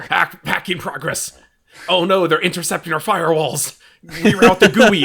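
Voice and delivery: laughing mock hacker panic. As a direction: ham it up like a crime show hacker. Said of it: "two people typing on a keyboard".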